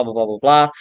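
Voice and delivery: normal talking voice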